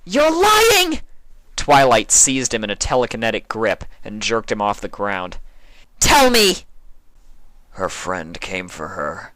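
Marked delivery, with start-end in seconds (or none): 0.0-1.0 very high, loud angry yell; furious scream. A narrator's reading. Twilight accuses. 6.0-6.6 very high, loud angry yell; furious scream. A narrator's reading. Twilight demands. 7.7-9.3 flat changeling. A narrator's reading. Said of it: "Sympathy's voice was flat".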